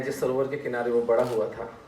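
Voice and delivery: ordinary talk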